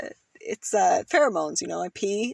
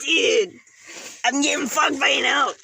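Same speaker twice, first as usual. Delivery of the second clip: higher-pitched mimicry of a buddy; imitating a friend's voice; 4 semitones higher than usual. Friend joking about an elk, imitating his buddy's voice. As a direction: put on your pal's distinct voice, pitched up. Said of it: "imitate him by doing his voice".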